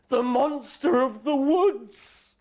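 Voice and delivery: high, loud, very slow spooky words; spooky dread. Acted. The friends fearing the monster, slow and spooky. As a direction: draw it out to scare the listener.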